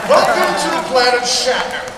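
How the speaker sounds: loud booming welcome; grand announcement. Real, not acted. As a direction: proclaim it like a ruler. Grand proclamation to crowd.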